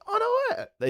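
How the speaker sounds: high, excited squeal of disbelief; delighted surprise. Retold or imitated. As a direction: squeal it like a thrilled stranger. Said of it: "They're like".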